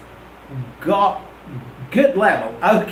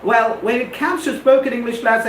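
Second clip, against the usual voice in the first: ordinary talk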